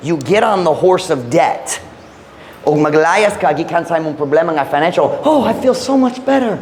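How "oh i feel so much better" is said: high, sing-song sigh of fake relief; sarcastic imitation of feeling better; mock relief. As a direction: poke fun at the false comfort of borrowing. Mocking the relief of new debt.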